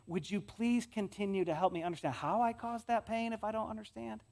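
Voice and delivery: usual speaking voice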